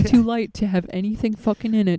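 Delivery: loud mock bro pep talk; egging-on bro. Co-host playing the man's friend egging him on, mock bro voice. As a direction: hype up your buddy, loud. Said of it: "his friend was like".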